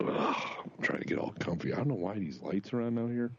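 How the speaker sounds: ordinary talk, nothing marked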